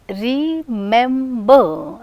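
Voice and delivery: "remember" drawn out as re, mem, ber; syllable by syllable. Shown as a demonstration. Teacher showing syllable structure in remember, slowly separated. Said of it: "the structure of the syllable".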